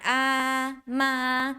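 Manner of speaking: AH and MA held level; first tone. Shown as a demonstration. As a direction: sustain "ah, ma" on one even pitch. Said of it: "First tone".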